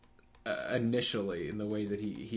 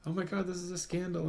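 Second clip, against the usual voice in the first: higher-pitched mock shock; mock scandalized; 8 semitones higher than usual. Acts out shocked reaction to scandal.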